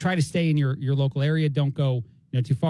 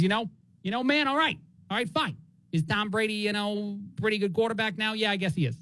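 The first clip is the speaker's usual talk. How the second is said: higher-pitched New York sports fan voice; New York accent; 5 semitones higher than usual. Pretend. Giants fan grudgingly praising Patriots owner, New York accent. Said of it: "I'm even doing the voice a little bit now".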